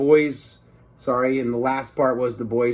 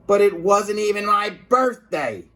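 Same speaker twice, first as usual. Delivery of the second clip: cried out; 8 semitones higher, 4 dB louder than usual. Acted. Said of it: "cried Mister Krupp".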